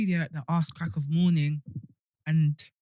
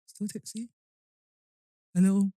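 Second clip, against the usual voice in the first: hungover; 3 semitones higher than usual. Real, not acted. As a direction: sound worn out and hungover. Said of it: "I am just hungover".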